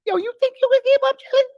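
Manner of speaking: very high, fast, loud taunt. Retold or imitated. Imitating his brother T on the stairs, high fast taunt. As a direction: imitate a squeaky, excitable challenger. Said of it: "And my brother T, he would be like".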